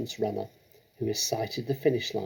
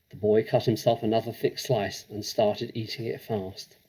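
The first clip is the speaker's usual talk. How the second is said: usual speaking voice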